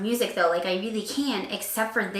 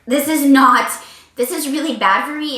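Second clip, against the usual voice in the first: loud outburst; 4 semitones higher, 8 dB louder than usual. Real, not acted. Strong dislike of the song.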